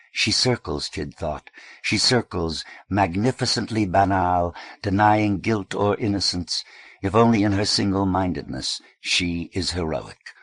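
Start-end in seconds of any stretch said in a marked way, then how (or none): none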